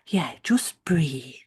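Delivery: slow, hushed reassurance; calm, gentle. Retold or imitated. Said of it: "he did say it so calmly".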